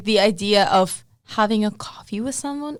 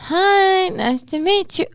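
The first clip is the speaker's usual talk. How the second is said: bubbly greeting; 10 semitones higher, 4 dB louder, slower than usual. Retold or imitated. Acting out going out.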